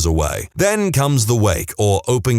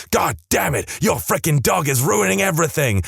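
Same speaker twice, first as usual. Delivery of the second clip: angry; 6 semitones higher than usual. Retold or imitated. Angry man yelling at wife.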